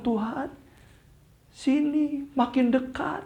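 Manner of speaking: high, drawn-out happy call. A narrator's reading. Tono calls the Lord closer in his dream, happy high call. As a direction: call eagerly in a high, slow voice. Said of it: "Tono seneng banget".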